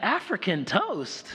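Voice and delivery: high, slow disbelieving question; mock incredulous kids. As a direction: echo it back like baffled children. Children repeat it in disbelief.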